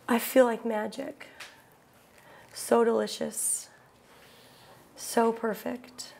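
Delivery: soft, low, slow savoring; blissful. Real, not acted. Telling the audience about pure bliss, soft and dreamy. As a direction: melt into the words.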